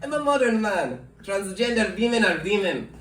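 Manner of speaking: excitable Russian man, voice leaping high; animated Russian suitor with swooping pitch; Russian accent. Acted. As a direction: reassure her eagerly in a Russian accent. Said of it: "that's what Russian people sound like".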